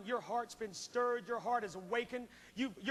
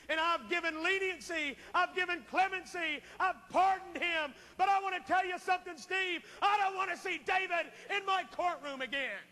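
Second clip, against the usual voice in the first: fiery preaching; 7 semitones higher, 5 dB louder than usual. Acted. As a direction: preach it to the back row. Preaching the judge's verdict.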